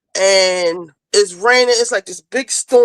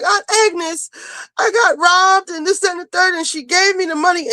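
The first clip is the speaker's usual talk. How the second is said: explaining niece; 9 semitones higher than usual. Retold or imitated. Niece explaining to her aunt.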